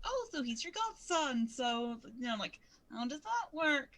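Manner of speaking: light, chirpy questioning tone; chatty high voice. Retold or imitated. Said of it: "is like".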